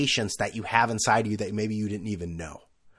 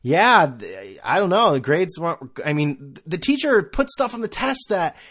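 higher-pitched, protesting schoolkid excuses; excuse-making teen; 5 semitones higher, 5 dB louder than usual. Retold or imitated. Son explaining bad grades to his father, defensive. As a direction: stammer out a whiny excuse, pitch jumping up. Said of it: "and I'd be like".

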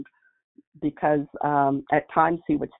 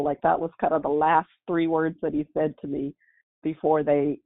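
normal talking voice

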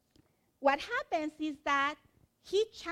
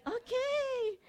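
stunned okay; 11 semitones higher than usual. Real, not acted. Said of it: "And I'm like".

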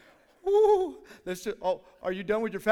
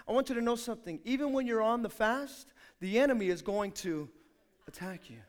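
normal talking voice

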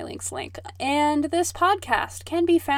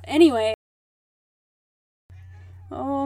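ordinary talk

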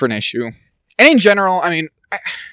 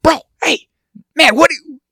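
high and louder, trying to get his attention; urgent call; 5 dB louder than usual. Retold or imitated. Tapping the friend to get his attention, high and urgent. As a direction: call out to him, pitched way up.

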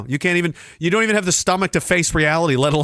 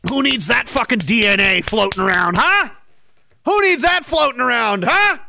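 sarcastic; 5 semitones higher than usual. Pretend. Sarcastic mocking question.

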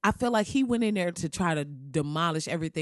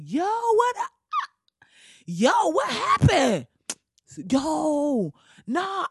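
much higher, slow, baffled voice; pitched-up confused comeback voice; bewildered Barack returning; 8 semitones higher, much slower than usual. Pretend. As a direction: play Obama stepping back in, stunned at the mess. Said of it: "I would just show up like".

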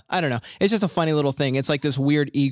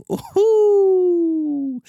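excited; 6 dB louder than usual. Real, not acted. Cheering his new intro.

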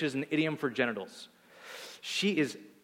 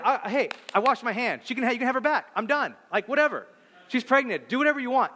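washing his hands of it; 8 semitones higher, 3 dB louder than usual. Retold or imitated. Abraham dismisses Sarah's complaint.

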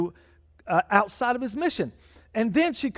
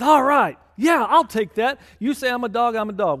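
eager acceptance; 3 semitones higher, 6 dB louder than usual. Retold or imitated. Woman accepts the name.

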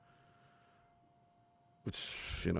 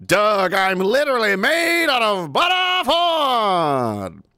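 shouting boxer character; 11 semitones higher, 8 dB louder, much slower than usual. Acted.

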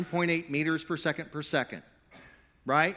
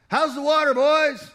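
much higher and louder hearty call; old fish yelling; 13 semitones higher, 11 dB louder than usual. Acted. Old fish yells a greeting to two young fish, loud call. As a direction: holler a greeting at passing youngsters. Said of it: "he yells out".

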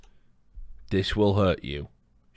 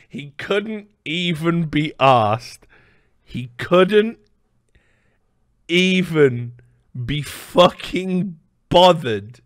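slow emphatic; 2 semitones higher, much slower than usual. Acted. Emphatic slow mock outrage.

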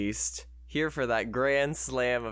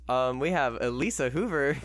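normal talking voice

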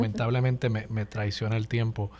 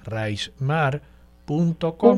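dictando la web; 3 semitones higher, 6 dB louder, much slower than usual. Shown as a demonstration. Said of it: "Voy a deletrear tu nombre".

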